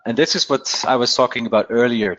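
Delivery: ordinary talk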